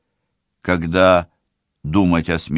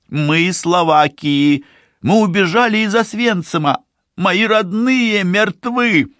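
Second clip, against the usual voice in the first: put-on desperate young woman, pitched high; man doing a woman's voice; 13 semitones higher, 3 dB louder than usual. A narrator's reading. Tsibi's desperate plea.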